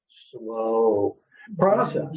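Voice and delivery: long, drawn-out slow, then process; said slowly. Shown as a demonstration. Counselor repeating slow process deliberately slowly. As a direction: lean on slow and let it hang. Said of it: "I'll say it slow even".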